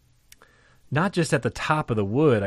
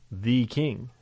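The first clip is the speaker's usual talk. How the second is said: usual speaking voice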